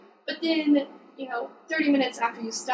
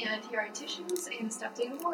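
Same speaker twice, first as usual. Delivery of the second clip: playful whisper; 9 dB quieter than usual. Pretend. Playful list of teacher duties.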